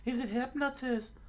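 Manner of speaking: very high falsetto statement; high cartoon explainer; explaining companion. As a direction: say HYPNOTIST matter-of-factly in a squeaky voice. First statement, misheard afterwards.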